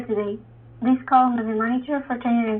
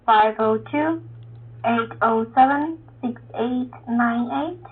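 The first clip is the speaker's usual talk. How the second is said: slow careful read-back; slower than usual. Real, not acted. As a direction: spell out each number slowly to verify. Interpreter verifying the phone number.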